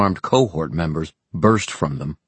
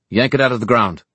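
raised, louder command; barked order; 6 semitones higher, 5 dB louder, faster than usual. Acted. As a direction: command them to tear it down. Villain orders tent pulled down.

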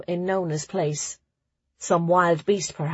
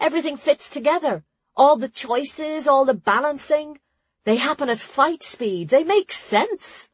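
put-on higher, animated girl's voice; Nona, delighted; 7 semitones higher than usual. A narrator's reading. Nona explaining blade path to Ruli, delighted. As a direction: enthuse as if it all suddenly fits.

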